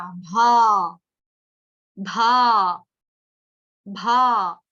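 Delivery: भा sung out long and loud; drawn-out भा. Shown as a demonstration. Teacher to class, loud held भा. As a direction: stretch भा and belt it. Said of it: "भ के साथ है आ की मात्रा".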